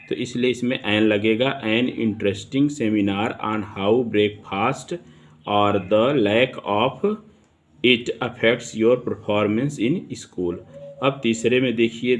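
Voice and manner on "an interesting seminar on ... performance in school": slow, deliberate example sentence read; dictation reading. Shown as a demonstration. Teacher reading an example sentence to students, slow and deliberate. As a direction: read it out slowly, word by word for the class.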